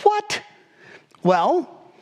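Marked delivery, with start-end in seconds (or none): 0.0-0.4 sudden high-pitched what; squeaky incredulous what; baffled what. Retold or imitated. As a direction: shoot the pitch way up in disbelief. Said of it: "we look at this and we go".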